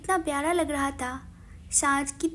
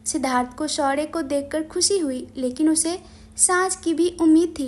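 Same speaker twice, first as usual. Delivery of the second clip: ordinary delivery, nothing marked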